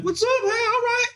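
high-pitched jiving greeting. Retold or imitated. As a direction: jive at him playfully. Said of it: "I'm all fucking jiving him".

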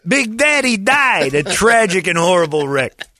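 mock tragic announcement. Mock dramatic news of death.

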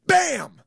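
high, loud shouted bam; mock gunshot yell. Acting out his final exit with a bang. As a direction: bark out a gunshot noise.

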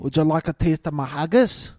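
unconvincing Scottish attempt; Scottish accent. Co-host trying number six again, bad Scottish. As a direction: put on a lilting, badly faked Scottish accent. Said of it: "Number six is Scottish".

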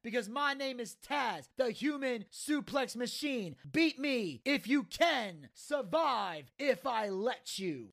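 passionate promo. Retold or imitated. Taz calls out Rob Van Dam, passionate promo. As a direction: proclaim it like a wrestler's sign-off. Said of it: "Taz coming out with the passionate promo".

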